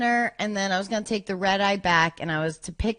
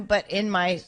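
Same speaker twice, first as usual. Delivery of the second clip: ordinary talk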